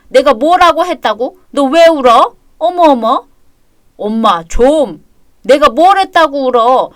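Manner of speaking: loud, defensive protest; flustered, annoyed mother-in-law; indignant. Acted. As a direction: protest hotly at being blamed.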